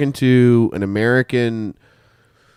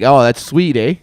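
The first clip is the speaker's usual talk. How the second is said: louder, light Canadian "eh" on the end; 3 semitones higher, 4 dB louder than usual. Retold or imitated. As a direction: lean into the Canadian twang. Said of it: "'Cause I'm always like".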